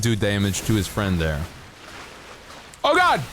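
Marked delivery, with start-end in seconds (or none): 2.8-3.2 sudden high, loud yelp of surprise; startled. Real, not acted. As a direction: jump at the blast. Startled by explosion.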